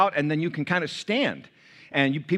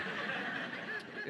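a quiet unvoiced chuckle; soft laugh; 15 dB quieter than usual. Real, not acted. Hinting which son, quiet chuckle. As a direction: chuckle under your breath.

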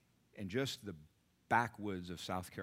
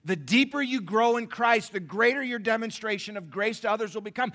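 shouted preaching; 14 semitones higher, 14 dB louder than usual. Shown as a demonstration. Preacher shouting the repeated line to the congregation. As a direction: hammer the line home. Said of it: "repeated louder".

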